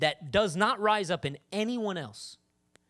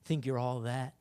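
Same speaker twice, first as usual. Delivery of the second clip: low, soft accusing voice; the tempter needling, dropped low and quiet; sneering enemy; 11 semitones lower, 8 dB quieter than usual. Acted. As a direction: mutter the taunt low, under your breath. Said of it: "he's gonna be like".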